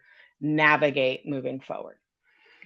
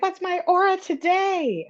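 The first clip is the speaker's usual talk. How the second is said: lightly voiced bubbly friend, raised high; high chirpy friend. Eager friend asking the psychic for her aura, chirpy high voice.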